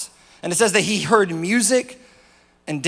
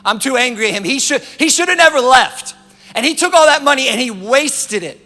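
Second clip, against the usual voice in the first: anger building to loud, raised pitch; angry older brother; 3 semitones higher, 5 dB louder than usual. Acted. Older brother resents the one who left, mounting anger. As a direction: work yourself up into loud resentment. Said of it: "The older brother became angry".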